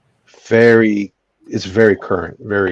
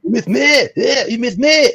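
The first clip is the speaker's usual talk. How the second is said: much higher, louder mock Belgian accent; Van Damme impression; 7 dB louder than usual. Friend repeating the Van Damme line, louder hammed accent. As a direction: ham up the action-star taunt. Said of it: "That's, that's Van Damme".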